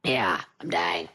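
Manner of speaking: raspy smoker's voice; throat worn rough from talking; hoarse voice. Real, not acted. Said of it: "I sound like I smoked twenty-seven packs of cigarettes".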